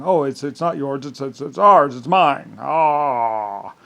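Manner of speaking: loud, sneering mimicry of greedy rivals; mock them as petty and grabby; mocking whine. Mocking rivals who claim ownership.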